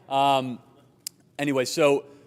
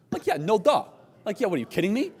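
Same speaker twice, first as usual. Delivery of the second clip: incredulous scoff; 7 semitones higher than usual. Real, not acted. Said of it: "mock disbelief".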